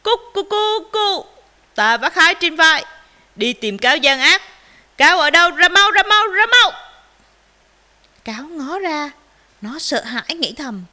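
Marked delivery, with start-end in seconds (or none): 0.0-1.3 high rooster crow; loud crowing. A narrator's reading. Rooster arrives at fox's house, crowing. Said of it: "gà trống gáy vang". 1.7-6.7 very loud challenge, rising high; ringing crow. A narrator's reading. Rooster summons fox outside, crowing loudly. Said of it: "gà trống gáy vang".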